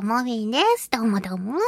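normal talking voice

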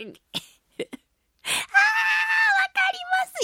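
high, squeaky wail of sympathy; mock-anguished high commiseration; hammed-up empathy. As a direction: squeal it in overdone fellow feeling. Acts out gushing empathy.

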